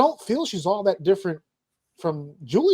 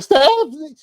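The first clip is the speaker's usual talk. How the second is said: mock voice crack; 8 semitones higher, 7 dB louder, slower than usual. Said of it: "You went through puberty for a second".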